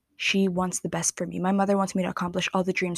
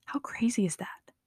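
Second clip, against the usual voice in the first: awed whisper; 2 semitones higher, 6 dB quieter than usual. Real, not acted. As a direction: whisper it, stunned. Awed reaction to the quote.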